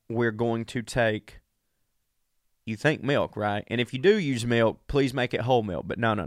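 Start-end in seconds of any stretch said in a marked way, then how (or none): none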